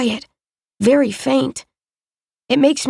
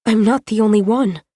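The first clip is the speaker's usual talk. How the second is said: ordinary delivery, nothing marked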